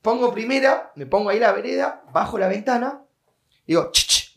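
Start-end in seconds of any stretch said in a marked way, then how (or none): 3.9-4.4 short sibilant hiss to catch someone's ear; two quick "ch" sounds, like calling someone over; attention-getting "ch, ch". Retold or imitated. From the car to the crying girl, attention-getting hiss. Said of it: "le hago".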